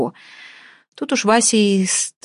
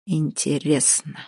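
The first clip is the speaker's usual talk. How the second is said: low musing; 3 semitones lower, 5 dB quieter, slower than usual. Acted. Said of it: "прокурорским голосом".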